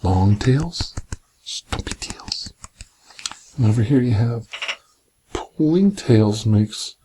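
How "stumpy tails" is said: slow hushed whisper; slow secret storybook whisper. Acted. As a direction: whisper it slowly, drawing it out for the child. Whispered line while reading picture book.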